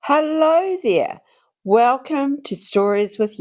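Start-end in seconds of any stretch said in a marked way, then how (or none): none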